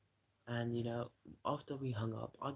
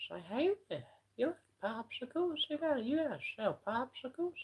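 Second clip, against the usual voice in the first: much higher, put-on squeaky Herbert voice; Herbert impression; 14 semitones higher, 5 dB louder than usual. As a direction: pitch it way up and play a creepy popsicle guy. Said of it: "he got me to do my Herbert impression".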